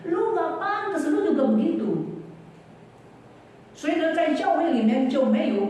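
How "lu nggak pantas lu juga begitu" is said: devil accusing. Retold or imitated. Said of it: "Iblis justru sering bilang".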